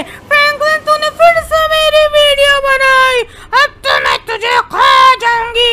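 slow, high, loud monstrous wail; furious ghost lady. Acted. Ghost lady threatens Franklin over the video, furious shriek. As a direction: howl the threat, drawing out each word.

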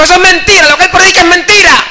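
high, loud outburst; mock heckler shout. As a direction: imitate a heckler crying out from the pews. Drunk heckler insists, retold.

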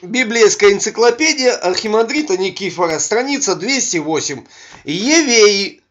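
usual speaking voice

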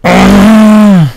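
short loud shriek; startled scream. Real, not acted. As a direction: scream in real shock. Another scare in game. (grito de sobresalto)

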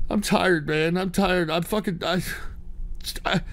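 low, quiet, defeated; worn out. Real, not acted. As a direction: trail off soft and low, exhausted. Worn out after ranting.

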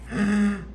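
high, sudden gasp of surprise; sharp gasp. Real, not acted. Card opener sees a good pull, sharp gasp. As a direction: gasp at a lucky find.

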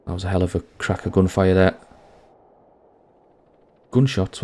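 normal talking voice

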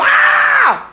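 very high, loud praise yell; loud shout. Acted. Believer shouting harder in crisis, loud yell. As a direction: let out a wordless high holler. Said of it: "Gritar más duro".

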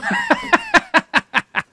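loud high burst of laughter. Real, not acted. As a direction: burst out in rapid high cackles. Greeting a known chatter, amused.

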